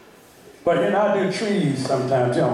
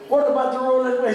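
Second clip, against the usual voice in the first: quoted rich man; 9 semitones higher than usual. Retold or imitated. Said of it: "He said".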